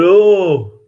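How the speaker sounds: imitating a dopey local in a Yorkshire accent; Yorkshire dialect. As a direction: put on a broad Yorkshire voice. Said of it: "That's how they talk around, around here".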